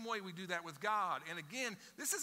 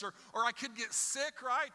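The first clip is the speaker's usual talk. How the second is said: incredulous, raised and louder complaint to Paul; exasperated objection; 5 semitones higher, 5 dB louder than usual. Retold or imitated. Anxious listener protesting to Paul, exasperated. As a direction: push back at Paul in disbelief. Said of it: "you gotta be like".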